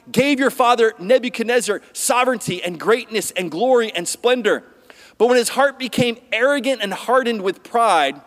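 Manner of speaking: formal scripture reading. Acted. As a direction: read it as proclaimed scripture. Reads scripture aloud.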